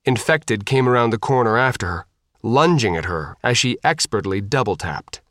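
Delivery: plain reading voice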